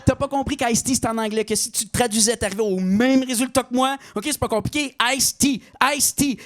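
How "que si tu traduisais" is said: ordinary delivery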